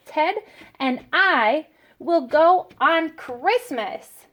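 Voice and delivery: slow, word-by-word example sentence; slow dictation. Shown as a demonstration. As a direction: read it out slowly like dictation for little kids. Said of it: "Remember the sentence we came up with was".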